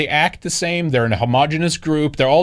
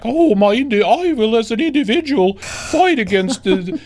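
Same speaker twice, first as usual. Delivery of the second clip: high, dramatic mock intellectual voice; hammed-up lofty lecturer; mocking Jordan Peterson; 9 semitones higher, 3 dB louder than usual. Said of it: "you have the Jordan Petersons out there like".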